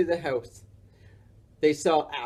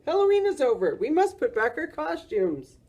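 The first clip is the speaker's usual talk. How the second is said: urgent voice; 11 semitones higher than usual. Acted. Morris continues shouting.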